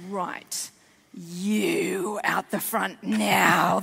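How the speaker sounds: low, slow command; stern teacher. Retold or imitated. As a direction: order the child to the front with cold menace. Strict teacher calls kid out.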